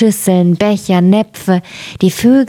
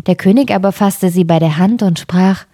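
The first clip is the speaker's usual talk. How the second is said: ordinary delivery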